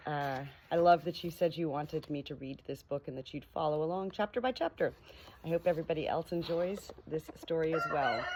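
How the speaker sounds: ordinary delivery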